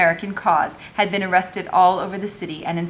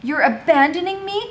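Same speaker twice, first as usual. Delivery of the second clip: higher, hurt question; voiced Madame hurt; 9 semitones higher, 4 dB louder than usual. A narrator's reading. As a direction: ask as if betrayed. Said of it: "Madame asked".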